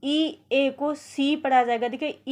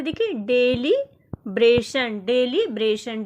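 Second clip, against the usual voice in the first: ordinary delivery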